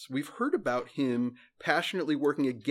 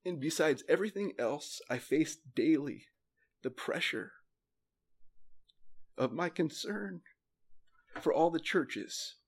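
real emotion, halting between phrases; emotional scripture reading. Real, not acted. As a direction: read haltingly, pausing to hold back feeling. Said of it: "get emotional about this".

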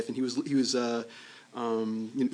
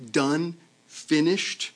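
slow emphasis; 2 semitones higher, much slower than usual. Real, not acted. Emphatic close of doctrine.

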